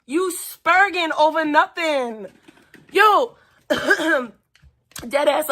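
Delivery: usual speaking voice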